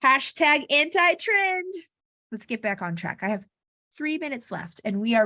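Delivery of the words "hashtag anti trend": slow, louder and higher chanted slogan; slogan called out to viewers, slow and loud. Shown as a demonstration. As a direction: punch out the catchphrase for viewers to join in. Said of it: "say it with me".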